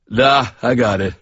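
a hint of a tipsy electrician brushing him off; drunk Chuck. A narrator's reading.

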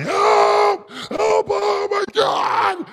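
imitating a terrified man's high-pitched cries for help; freaking-out shriek, acted out in a retelling; retold panicked scream. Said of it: "this guy's screaming behind me".

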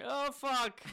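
high, loud yell of alarm; panicked yell. Acted. Ship's helmsman realizing he is stuck, panicked yell. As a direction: cry out in mock panic. Acting panicked guy on the ship.

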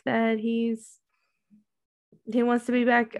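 ordinary delivery

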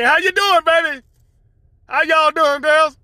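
high, loud sweet greeting; greeting spirit sisters. Pretend. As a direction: call out sweetly to the girls. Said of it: "I call them Cookie and Connie".

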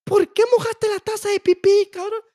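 put-on high, loud, slow scolding old lady; scolding grandma. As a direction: nag like an angry grandmother.

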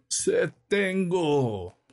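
high, long-drawn lament from the pulpit; mournful preaching. A narrator's reading. Priest repeating Christ's words on Calvary, very slow and mournful. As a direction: modulate it slowly, dying away sadly. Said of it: "modulando la voz y prestando a su acento la más triste".

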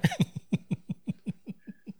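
bright burst of laughter; high laugh. Real, not acted. Laughing at own joke.

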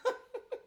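giggle. Real, not acted. Laughing mid-scene.

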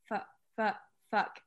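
put-on child sounding out, word by word, with gaps; mock beginner reading, one word at a time. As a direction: say it haltingly like an early reader. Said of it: "like, sound out words and stuff".

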